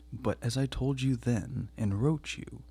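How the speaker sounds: ordinary delivery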